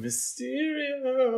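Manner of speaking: very high, slow, drawn-out Mysterio; a theatrical ta-da on the villain's name; dramatic name drop. Pretend. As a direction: stretch the name out like a spooky reveal.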